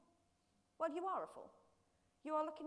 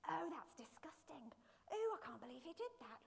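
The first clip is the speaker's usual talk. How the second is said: softened, appalled onlooker; acted squeamish bystander; mock disgust; 4 semitones higher, 6 dB quieter than usual. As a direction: recoil quietly in disgust.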